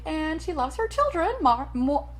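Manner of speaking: American mother voice. Acted. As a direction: play the fussy American mom who adores her kids. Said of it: "she is an American mother".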